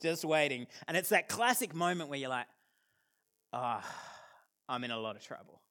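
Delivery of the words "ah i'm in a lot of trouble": soft, low sinking realization; dread. Retold or imitated. Kid caught by waiting dad, soft low dread. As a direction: let the dread drop out quietly.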